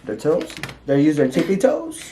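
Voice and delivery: loud, high disbelieving question; teasing shock, voice jumping up; incredulous. Real, not acted. Teasing surprise about eating with feet.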